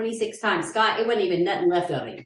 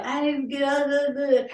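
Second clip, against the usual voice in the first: higher-pitched garbled blah-blah chatter; mock movie dude; 6 semitones higher, slower than usual. Bored viewer imitating the dude in the car, mocking gibberish. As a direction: imitate a film character droning nonsense syllables, pitched up. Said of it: "He's like".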